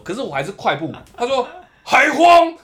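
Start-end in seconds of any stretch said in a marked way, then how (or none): 1.8-2.5 very high, loud one-word bark; sharp rebuke. Retold or imitated. Officer catches him still hurrying, sharp loud bark. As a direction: snap at him.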